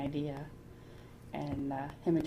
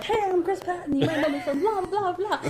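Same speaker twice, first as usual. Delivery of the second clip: high, mock self-introduction; hammed-up eager stage greeting; Chris Patton; 13 semitones higher than usual. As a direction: grab the mic and announce yourself, pitched way up. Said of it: "he's like".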